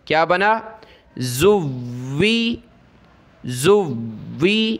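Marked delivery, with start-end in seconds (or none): 1.2-4.8 زوی slowed with the واو pressed hard; stressed doubled waw. Shown as a demonstration. Teacher drilling the doubled waw with pupils. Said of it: "واو کو سخت کریں گے شد کی وجہ سے".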